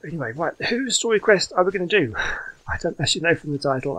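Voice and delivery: ordinary talk, nothing marked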